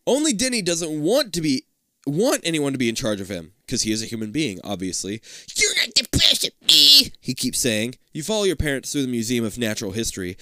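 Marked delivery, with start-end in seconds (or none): 5.6-7.1 high, loud bratty protest; bratty little brother. Acted. Little brother defying the older sibling in charge, bratty. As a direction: whine it like a defiant kid brother.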